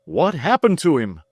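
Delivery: high, pressing question; Mister Damon begging for news of Tom; anxious cry. A narrator's reading. Mister Damon worried for his friend, crying out anxiously. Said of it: "cried Mister Damon".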